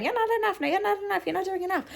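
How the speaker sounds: very high, squeaky little inner critic; nagging shoulder voice. Retold or imitated. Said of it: "this one on my shoulder going".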